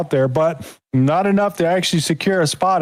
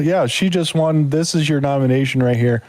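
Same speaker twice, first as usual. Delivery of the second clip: normal talking voice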